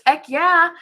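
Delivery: high loud cheer; excited cheer. Real, not acted. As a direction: cheer for the new signup. Cheering a signup.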